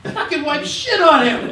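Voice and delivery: high, loud and slow, laughing through it; retold disbelief, cracking up; laughing mock outrage. Quarterback complaining to the huddle, laughing outrage.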